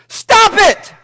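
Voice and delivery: high, loud blast of a command; curt therapist yelling at his patient; sudden shout. Acted. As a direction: bark it at her out of nowhere. Therapist barks his two-word cure.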